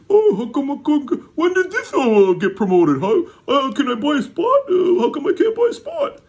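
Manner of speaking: very high, loud squeaky whine; mock a pestering buyer in a shrill-pitched whine; whiny customer. Vlogger mocking a viewer begging for a spot, high whiny voice. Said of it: "Someone's like".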